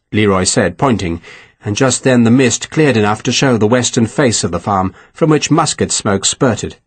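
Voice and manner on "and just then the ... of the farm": ordinary delivery, nothing marked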